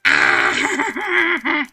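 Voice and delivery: sudden loud yelp; startled shout. Real, not acted. Player startled by game event, loud yelp. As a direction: yelp in surprise.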